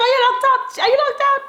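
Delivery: high scolding mother, pitched well up; mother's voice. Retold or imitated. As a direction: play the bossy mom, pitched high. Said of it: "she's like".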